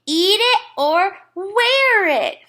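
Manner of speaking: put-on high, loud, slow toddler scream. A narrator's reading. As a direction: yell it like a furious little kid. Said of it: "Fudge screamed".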